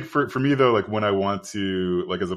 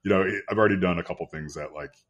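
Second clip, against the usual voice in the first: normal talking voice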